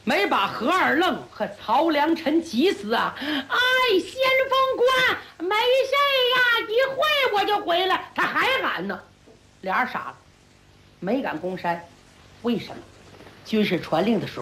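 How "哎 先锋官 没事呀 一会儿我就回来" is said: higher, carefree shout from afar; calling out. Acted. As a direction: call back over the wall. 在寨内喊话. (隔着寨门高声喊话)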